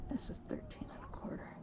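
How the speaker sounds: breathy whispered aside; hushed whisper, just air. Real, not acted. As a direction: whisper it to the camera. Hushed aside before fishing.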